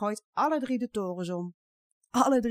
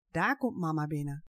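usual speaking voice

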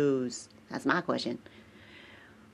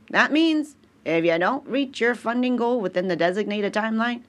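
ordinary delivery

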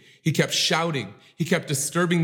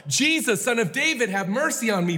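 higher desperate cry to Jesus; 7 semitones higher than usual. Acted. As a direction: call out over the crowd. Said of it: "screamed on the top of his lungs".

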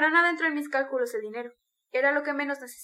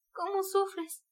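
higher, pained lament; moaning; 6 semitones higher, much slower than usual. A narrator's reading. Sonia grieving at his suffering, moaning. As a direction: moan it with pity. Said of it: "Gimió Sonia".

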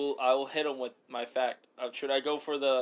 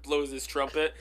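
normal talking voice

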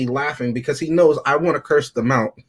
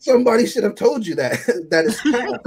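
laughing; 5 semitones higher than usual. Real, not acted. Laughing at own joke.

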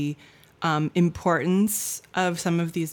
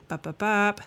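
mock beeping noises; 4 semitones higher than usual. Filler noise after apology.

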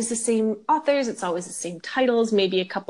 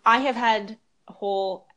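ordinary delivery

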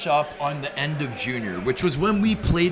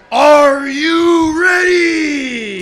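drawn-out, higher, much louder, slow are you ready; metal hype scream; 9 semitones higher, 12 dB louder, much slower than usual. Retold or imitated. Assistant coach firing the team up, screaming. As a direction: scream it like a heavy metal frontman. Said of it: "get us fired up".

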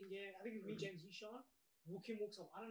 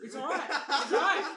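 raised, louder reassurance through laughter; mock urgent reassurance; 5 semitones higher, 10 dB louder than usual. Teacher telling James he can talk, hammed-up reassurance. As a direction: build louder on the repeat, laughing.

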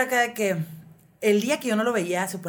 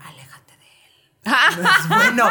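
eerie whispered warning, like a horror film; mock horror warning; 13 semitones higher, 8 dB louder, slower than usual. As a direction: hiss it like a ghost in a horror movie. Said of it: "en pedo película de terror".

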